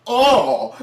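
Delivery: loud, high imitated "oh"; mock pained yelp. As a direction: yelp as if kicked from behind. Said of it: "He goes".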